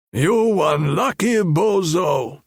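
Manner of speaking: slow movie-gangster line; Hollywood tough guy. A narrator's reading. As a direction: drawl it like a film heavy. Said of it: "he said in English".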